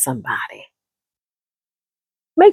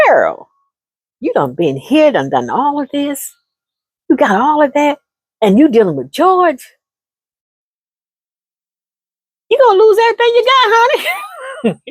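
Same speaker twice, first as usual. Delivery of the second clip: scolding friend; 6 semitones higher than usual. Acted. Warning her girlfriend about George, building up. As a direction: build from knowing to alarmed.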